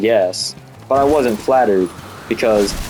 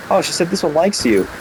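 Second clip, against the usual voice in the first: higher-pitched imitation of mom; mom's voice imitated; 8 semitones higher than usual. Mom passing on a crush, lightly imitated.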